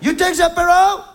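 high, loud boozy bellow; drunk camper impression. Drunk ex-trucker offering a drink, loud boozy bellow. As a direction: bellow the invitation like a tipsy trucker. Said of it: "Il était capable de dire".

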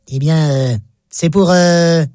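low, hesitant customer; embarrassed. Acted. Shy man asking a pharmacist for condoms, embarrassed. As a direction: hem and haw, dropping the pitch. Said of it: "Un homme embarrassé".

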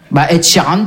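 ordinary talk

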